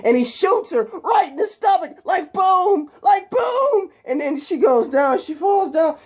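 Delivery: high-pitched, squealing action retelling; worked-up, very high storytelling; frantic climax. Retold or imitated. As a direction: act out the shooting at peak excitement. Climax of the retold fight.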